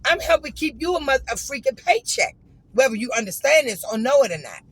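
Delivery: loud, high, exasperated complaint; loud rant. Retold or imitated. Ranting to the bartender about rude service, loud and exasperated. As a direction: vent the whole grievance at full volume.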